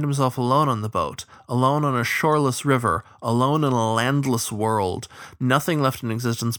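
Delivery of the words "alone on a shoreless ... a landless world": ordinary delivery, nothing marked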